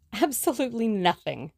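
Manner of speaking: cheerily. A narrator's reading. Tommy to Tuppence, cheerily admitting they have nothing. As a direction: toss it off gaily, unbothered. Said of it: "said Tommy cheerily".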